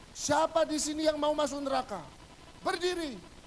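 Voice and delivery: high-pitched, forceful pulpit challenge; preaching pastor. Acted. Pastor demanding that sinners stand up, high forceful preacher's call. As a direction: suddenly thunder a challenge at the congregation. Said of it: "tiba-tiba pendeta gini".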